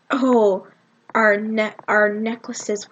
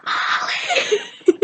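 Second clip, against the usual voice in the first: very high, thin squealed call; mock a friend squealing a name across seats; excited squeal. Becky stands and turns to Molly, excited squeal.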